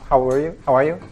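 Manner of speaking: normal talking voice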